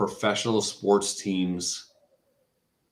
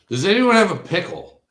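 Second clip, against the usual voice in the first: calling out; 10 semitones higher, 5 dB louder than usual. Retold or imitated. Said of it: "the crowd and I go".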